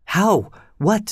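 high, urgent questions; exclaiming. A narrator's reading. Young woman eagerly pressing the narrator for his plan. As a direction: burst out with sudden hope. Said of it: "Solange exclaimed".

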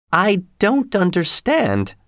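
high, slow, puzzled woman's voice; baffled Missus Giry. A narrator's reading. Giry baffled by manager's remark.

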